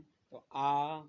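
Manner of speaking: teacher holding a long vowel for the class; long vowel demo. Shown as a demonstration. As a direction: hold the आ out loud and long to show a long vowel. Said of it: "चला गया लघु स्वर में".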